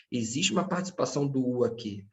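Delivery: normal talking voice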